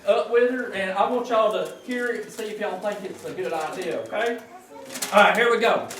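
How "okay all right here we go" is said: ordinary talk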